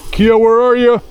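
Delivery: loud, high call to the dog; calling the dog Kia for a treat. Real, not acted. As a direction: call her over across the camp.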